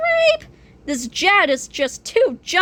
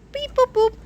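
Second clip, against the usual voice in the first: phone beeps; 10 semitones higher than usual. Acted. Imitated phone dialing.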